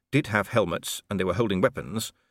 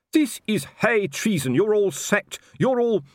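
high, loud raging shout; furious; 13 semitones higher, 5 dB louder than usual. Acted. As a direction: bellow at mutineers. Colon raging at strikers.

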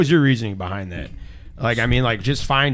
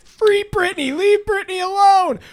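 mocking quote; slower than usual. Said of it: "these same people who are like".

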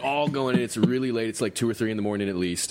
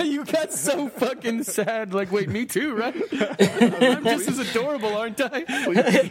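higher, slow, laughing mockery; mock whiny; 12 semitones higher, 3 dB louder, slower than usual. Host teasing cohost feeling left out, mock whiny. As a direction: tease him as a needy kid.

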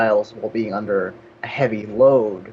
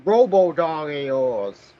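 slightly higher, grumbling villain parody; unmasked cartoon villain; 4 semitones higher, slower than usual. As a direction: grumble like a foiled cartoon villain, dragging the words. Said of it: "Nice Scooby-Doo joke there".

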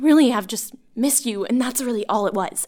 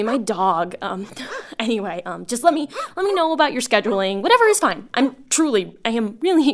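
normal talking voice

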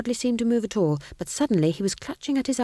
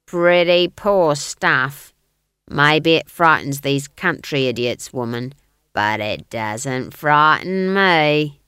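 loud, slow guard voice; 2 semitones lower, 6 dB louder, slower than usual. A narrator's reading. Guard belittling a witch's magic, loud slow role voice. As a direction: boast slowly and loudly as the guard. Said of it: "said the guard".

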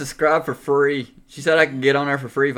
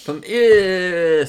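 mocking imitation; 4 semitones higher, 4 dB louder than usual. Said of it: "make fun of the way I talk".

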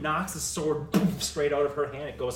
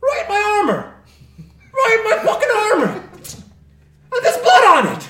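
shrieking far higher and much louder; outraged yelling; 17 dB louder, faster than usual. Acted. Grim shrieks after a dagger hits his armor. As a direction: howl in outrage at the wound.

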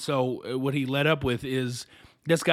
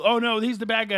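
mock alarm; 7 semitones higher than usual. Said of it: "and so they go".

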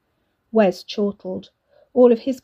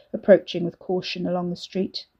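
ordinary delivery, nothing marked

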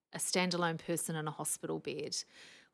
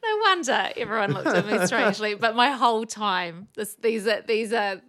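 louder, laughing aftermath; amused laughing tone; 5 semitones higher, 10 dB louder than usual. Real, not acted. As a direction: still giggling at yourself. Still laughing after story.

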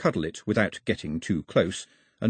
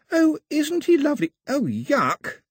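put-on high girl voice; slower than usual. Daphne coos then recoils.

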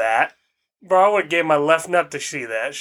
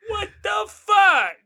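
mock outraged shout; slower than usual. Real, not acted. Shouting at his friend in mock outrage. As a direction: yell it in fake shock.

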